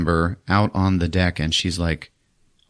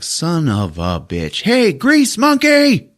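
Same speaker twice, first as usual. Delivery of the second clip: slow, much higher hammed-up call; mock yell; 10 semitones higher, much slower than usual. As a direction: bellow down for the engine man. Captain yelling to engine man.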